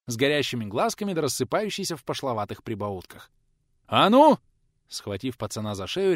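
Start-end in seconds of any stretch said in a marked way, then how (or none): 3.9-4.4 sudden loud bark. A narrator's reading. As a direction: snap it out hard and loud. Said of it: "рявкнул пожилой человек". (громкий рык)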